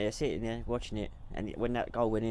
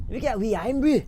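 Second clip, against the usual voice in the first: loud hammed-up Scottish accent; a quick bad Scottish accent; 10 semitones higher, 9 dB louder than usual. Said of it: "Give me your best Scottish accent".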